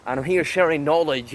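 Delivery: ordinary delivery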